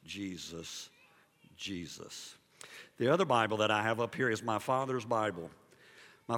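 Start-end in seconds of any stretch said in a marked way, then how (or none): 0.0-2.4 very soft, low repeated call, as if heard from afar; pastor's quiet calling. Retold or imitated. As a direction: imitate a pastor softly calling on the name, pausing between calls. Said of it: "I heard Pastor Couples going".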